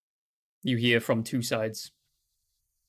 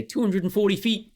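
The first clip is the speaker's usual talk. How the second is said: high, louder tree answer; the rival trees pipe up high; talking trees; 9 semitones higher, 5 dB louder than usual. Acted. Other trees give a rival answer, high and louder. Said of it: "the other two say".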